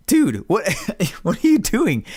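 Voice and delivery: high, laughing 'what are you doing'; laughing disbelief. Retold or imitated. To the recruit with his magazine in backwards, laughing in disbelief. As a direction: crack up at a ridiculous mistake.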